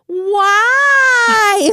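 squeaky, whiny high wail; weird high-pitched voice. Retold or imitated. Husband reacting to being scared, squeaky high whine. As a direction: squeal it out, startled and hurt. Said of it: "in this weird high-pitched voice".

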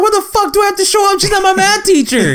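laughing through a high outraged shout; mock outraged shout. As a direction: shout it like the idea is absurd. Retold outrage at teacher.

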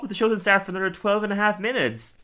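high, panicked squeak of a writer; mock panicked writer. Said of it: "And someone's like".

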